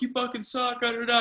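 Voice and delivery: mock heckler yelling, pitched high; heckling fan. Fan heckling a player from the stands, mocking yell. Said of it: "a fan who's like".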